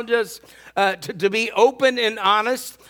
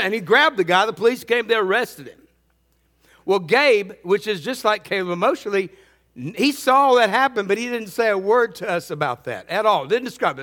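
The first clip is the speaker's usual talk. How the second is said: ordinary delivery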